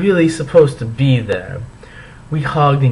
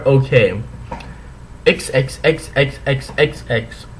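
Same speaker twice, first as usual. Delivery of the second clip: ordinary delivery, nothing marked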